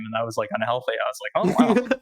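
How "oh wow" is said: surprised. Retold or imitated. Retold own shocked reaction.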